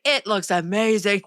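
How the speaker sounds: slow, gushing compliment; warm compliment. Retold or imitated. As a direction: praise your daughter sweetly. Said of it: "He's like".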